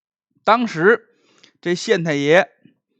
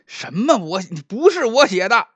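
nervous protest; 6 semitones higher, 4 dB louder than usual. Acted. Magistrate protests, frightened.

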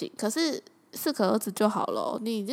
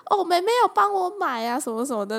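bragging mom; 7 semitones higher than usual. Retold or imitated. Mom boasting to the neighbor about her gift. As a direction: show off like a proud mother.

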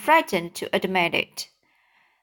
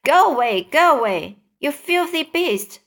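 raised, urgent shout to drive something off; 5 semitones higher, 4 dB louder than usual. A narrator's reading. Travellers to the shark at the peach, shouting. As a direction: shout it in alarm, louder or higher. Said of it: "Then they shouted".

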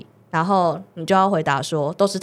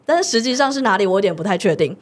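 ordinary delivery

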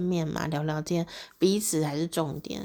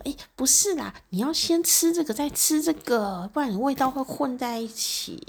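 higher, pushy correcting of a guest; mock-fussy hostess nagging, higher pitch; 8 semitones higher than usual. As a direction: fuss over the eating order like an anxious host. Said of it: "很烦躁的主人".

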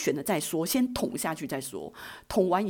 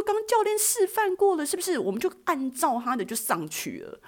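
breezy confidence; 11 semitones higher than usual. Retold or imitated. Boasting to doubtful friends.

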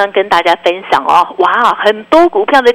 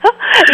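high giggle. Real, not acted. Host laughs again.

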